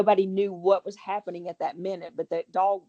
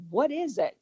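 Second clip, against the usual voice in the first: asking the dog; 7 semitones higher than usual. Retold or imitated. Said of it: "And then I said".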